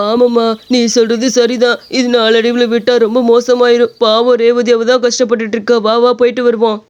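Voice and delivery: lightly voiced son agreeing with his mother; a touch of concern in a put-on man's voice; worried son. (கவலையோடு பேசும் மகன்)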